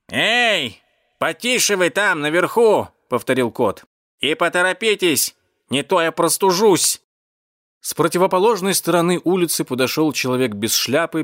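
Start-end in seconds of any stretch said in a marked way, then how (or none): 0.1-2.9 high, drawn-out call from below. Acted. Cat in the manhole hushes those above, calling out. 4.2-7.0 high, impatient call; calling up impatiently. Acted. Cat in the manhole urges rescuers above, calling impatiently.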